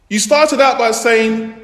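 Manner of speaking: normal talking voice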